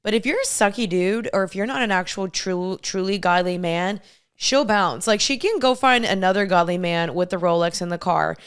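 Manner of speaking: ordinary delivery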